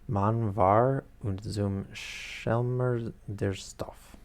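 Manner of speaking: self-mocking German read in a French accent, slow; terrible German. Shown as a demonstration. Reader warns chat before she reads Goethe in bad German with French accent. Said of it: "my terrible German".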